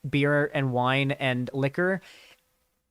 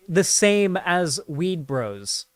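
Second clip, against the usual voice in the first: ordinary talk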